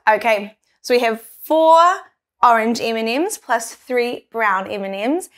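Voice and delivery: ordinary talk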